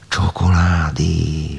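slow, breathy whispered word of wonder. Acted. Tánya discovers the hidden chocolate, whispering slowly. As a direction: whisper it like a secret treasure found. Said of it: "suttogta Tánya".

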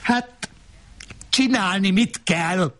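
high-pitched yelled question; yelling. A narrator's reading. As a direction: yell it in the heat of a row. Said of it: "Ordítoztunk".

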